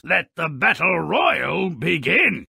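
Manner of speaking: ordinary delivery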